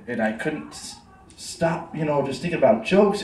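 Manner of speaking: ordinary talk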